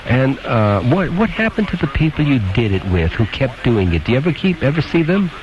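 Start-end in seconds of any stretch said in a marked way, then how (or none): none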